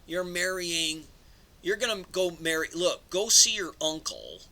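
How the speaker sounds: a hint of a mob boss giving orders; bossy elder ordering. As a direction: lay down the law to the young man. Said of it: "this is a mob type of mentality".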